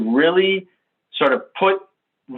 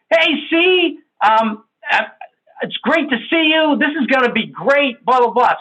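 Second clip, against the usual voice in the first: high, bubbly over-friendly greeting; an eager teammate knocking on a car window, bubbly and high; gushing S type; 8 semitones higher than usual. Acted. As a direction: greet a coworker far too eagerly, pitched up.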